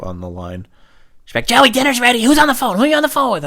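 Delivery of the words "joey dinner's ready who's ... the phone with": very high, loud mom voice; yelling mom. Retold or imitated. Mom interrupts her son's phone call, high loud yelling. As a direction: holler it into the extension.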